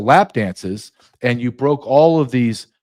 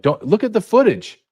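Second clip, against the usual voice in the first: mock official; 7 semitones higher than usual. Mocking official line.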